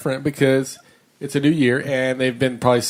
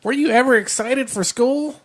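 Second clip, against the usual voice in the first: high, slow kiddie voice; childlike question; 10 semitones higher than usual. Acted. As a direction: ask it like a little kid. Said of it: "Who would write that?".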